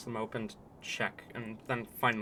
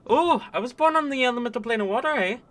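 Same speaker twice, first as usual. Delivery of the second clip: put-on high, loud, quick Canadian accent; excitable Canadian character voice; 13 semitones higher, 11 dB louder, faster than usual. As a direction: chirp it out as a broad Canadian. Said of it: "just gonna be Canadians".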